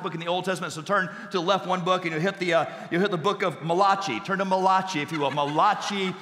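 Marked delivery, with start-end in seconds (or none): 3.6-4.2 Malachi said as ma-LAH-chee; mock Italian pronunciation. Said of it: "the only Italian prophet in the Bible". 4.4-5.0 Malachi said as ma-LAH-chee; mock Italian pronunciation. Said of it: "the only Italian prophet in the Bible". 5.3-6.1 Malachi said as ma-LAH-chee; mock Italian pronunciation. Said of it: "the only Italian prophet in the Bible".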